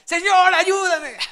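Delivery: loud, high cry for help; panicked shout. Acted. Sinking Peter crying to Jesus, panicked shout. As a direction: yell it like a man going under. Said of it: "Pedro empezó a gritar".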